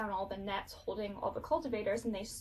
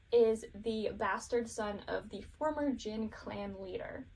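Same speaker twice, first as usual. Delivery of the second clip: ordinary delivery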